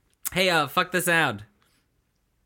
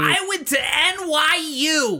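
New York guy voice; 10 semitones higher, slower than usual. Retold or imitated. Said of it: "that guy".

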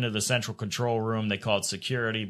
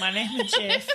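mocking; 12 semitones higher than usual. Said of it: "My name's Jeff".

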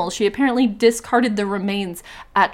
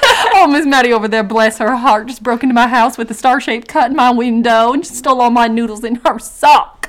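louder Southern lilt with laughter; mock Southern gossip; 2 semitones higher, 6 dB louder than usual. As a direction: gossip like a sweet Southern lady.

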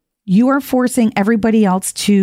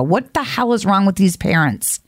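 normal talking voice

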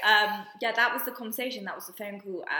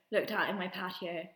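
usual speaking voice

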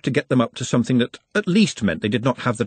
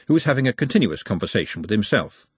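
usual speaking voice

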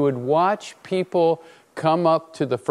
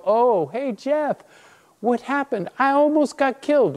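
high, sing-song mimicry turning indignant; acted exasperated reply, pitched way up; mocking indignation; 11 semitones higher than usual. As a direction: throw their greeting back in mock outrage. Mock reply to oblivious friends.

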